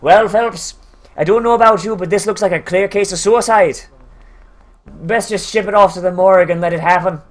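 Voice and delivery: hammed-up Irish cop brogue; Irish accent. Cop explaining away a murder as suicide, mock Irish accent. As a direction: reason absurdly in a put-on Irish accent. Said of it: "that's the thing with the Irish accent".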